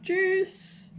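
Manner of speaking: high-pitched mock-cute goodbye; high, playful farewell; playful sign-off. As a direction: sing out a jokey bye well above your voice. Playful German sign-off, then self-mocking.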